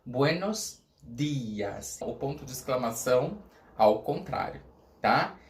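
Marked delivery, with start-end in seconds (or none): 0.0-2.0 buenos dias drawn out very slowly; slow Spanish. Shown as a demonstration. Teacher models buenos dias slowly.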